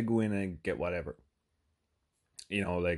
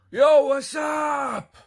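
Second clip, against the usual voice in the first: high, drawn-out holler imitating a loud buddy; screamed greeting; 9 dB louder, much slower than usual. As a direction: yell it across a store, long and high. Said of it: "because he screams".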